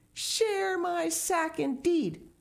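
high, slow, outraged scoff; greedy boy scorning the tiger. Acted. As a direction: drag out the disbelief.

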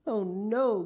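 put-on appalled little boy; horrified cry. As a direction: cry out in protest at the very idea. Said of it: "cried William".

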